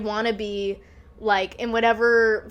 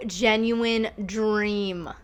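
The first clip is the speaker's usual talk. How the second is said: usual speaking voice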